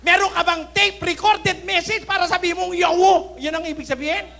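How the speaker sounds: loud, high-pitched berating; angry challenge. Real, not acted. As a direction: demand proof in an outraged shout. Angrily challenging someone to show proof.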